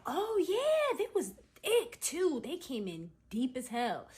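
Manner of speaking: high, emphatic gossip, drawing words out; animated maintenance man. Retold or imitated. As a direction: start high and loud, slowing as you drop. Quoting animated maintenance man's gossip.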